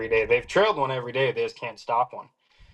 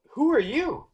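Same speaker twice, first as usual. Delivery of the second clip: much higher, caught off guard; startled question; 12 semitones higher than usual. Retold or imitated. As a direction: blurt it like he just jumped out at you. Stranger appears in the thicket.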